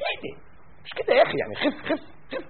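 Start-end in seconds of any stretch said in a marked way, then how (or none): 1.4-2.4 mock whisper. Mocking advice to calm down.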